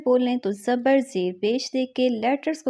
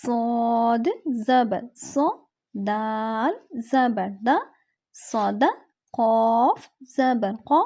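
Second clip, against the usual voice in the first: slow spelling drill; much slower than usual. Shown as a demonstration. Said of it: "آگے ہم پڑھیں گے".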